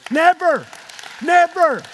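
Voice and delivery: loud, falling cries of never; emphatic shout. Real, not acted. Preacher insisting to the church, emphatic loud never. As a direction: hammer the word home to the congregation.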